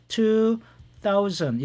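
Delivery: two drawn out slowly, then thousand falls; model reading. Shown as a demonstration. Teacher models two thousand slowly for learners. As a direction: stretch the word two, then drop on thousand. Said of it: "두 자리씩이 아니라 two thousand".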